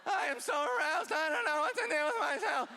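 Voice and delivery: hammed-up helpless excitement; mock overwhelmed arousal. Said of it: "you're like".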